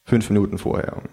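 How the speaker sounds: ordinary delivery, nothing marked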